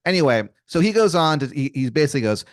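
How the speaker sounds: ordinary talk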